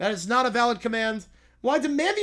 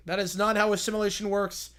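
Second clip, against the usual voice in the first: usual speaking voice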